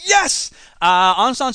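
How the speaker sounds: sudden high yelp of joy; a quick thrilled whoop; excited cheer. Real, not acted. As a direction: cheer the good news out loud. Cheering good news from Burma.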